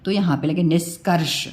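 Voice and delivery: ordinary delivery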